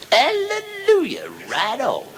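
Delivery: high, ringing hallelujah; gospel cheer. Acted. Friends at the bar cheering the brag, high gospel shout. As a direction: shout it up to the rafters like church.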